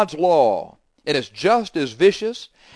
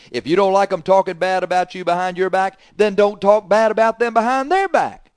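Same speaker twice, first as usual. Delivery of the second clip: louder and higher, rising into a near shout; preaching climax; 6 semitones higher, 6 dB louder than usual. Real, not acted. Preacher hammering home the golden rule, louder and higher. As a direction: build to a forceful shout.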